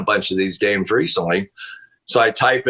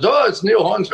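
high-pitched, badly faked British accent; 11 semitones higher than usual. Football analyst retelling a British stranger's phone call, bad British accent. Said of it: "with this British accent".

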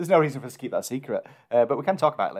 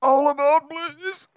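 train conductor call; 13 semitones higher, slower than usual. Pretend. Mock train announcement call.

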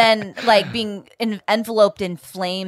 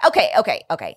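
normal talking voice

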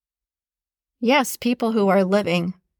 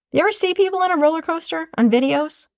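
usual speaking voice

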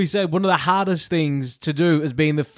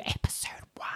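whispered; 16 dB quieter, faster than usual. Real, not acted. Dramatic show announcement trails off.

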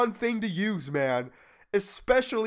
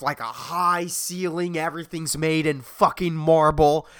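ordinary talk

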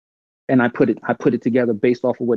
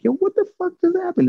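pitched way up, mock stunned. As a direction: blurt it out, thrown for a loop like your friend was. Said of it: "The same way I was".

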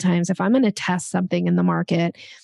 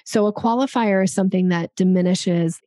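ordinary delivery

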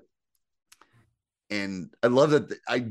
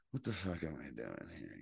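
very quiet, low undertone; imitating someone privately doubting, hushed and low; slight private unease; 5 semitones lower, 17 dB quieter than usual. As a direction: say it under your breath, as a barely showing aside. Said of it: "you could tell just slightly that she's like".

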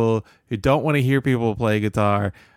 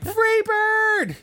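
very high-pitched mock holler; drawn-out falsetto song request; mock audience request. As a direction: squeal it out like a hammed-up heckler. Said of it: "they're all like".

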